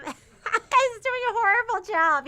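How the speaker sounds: laughing through the words, high and loud. Real, not acted. Host laughing at Max parking on stream, laughing. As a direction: giggle it out at Max's parking. Watching store kid park badly.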